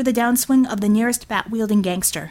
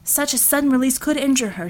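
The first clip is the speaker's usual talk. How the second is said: usual speaking voice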